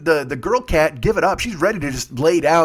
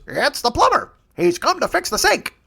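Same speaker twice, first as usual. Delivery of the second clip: higher squawking parrot mimicking the plumber; 9 semitones higher than usual. The jagoff parrot repeats the plumber's words in a high squawk. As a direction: parrot mocks the plumber's line, pitched up. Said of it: "the parrot goes".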